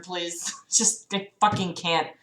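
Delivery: usual speaking voice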